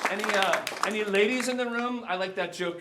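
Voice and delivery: loud, high call to the crowd; calling to audience. Real, not acted. Speaker asks the audience for the ladies, calling out loudly. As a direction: call it out over the room.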